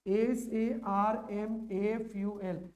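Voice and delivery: letters spelled one by one, very slowly; slow spelling out. Shown as a demonstration. Teacher to class spelling a word, very slow dictation. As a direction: dictate each letter so students can write it. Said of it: "हम लोग कैसे लिखते हैं".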